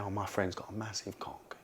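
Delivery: low, hushed, secretive boast; hushed brag. Retold or imitated. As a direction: friend boasts to a girl, quietly hushed. Friend bragging to a girl.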